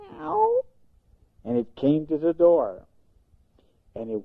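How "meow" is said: high little mew; kitty meow. Acted. A kitten mewing as it walks up the driveway.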